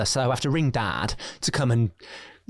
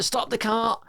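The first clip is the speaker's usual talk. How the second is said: high mock-shouted old nan; nan shouting; 11 semitones higher, slower than usual. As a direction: imitate nan demanding you stop. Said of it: "She's like".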